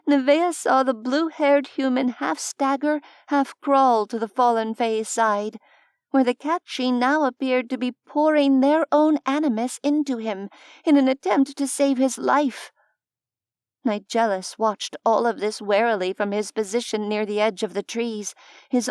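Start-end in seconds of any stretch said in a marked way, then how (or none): none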